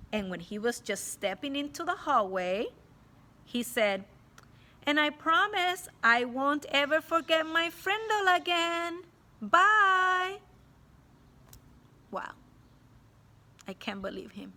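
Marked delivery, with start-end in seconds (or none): 4.9-10.4 high, loud cheeky farewell; cheeky boy. A narrator's reading. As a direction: toss it back on the way out. Boy's cheeky parting line.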